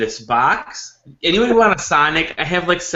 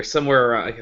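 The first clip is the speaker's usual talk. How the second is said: ordinary talk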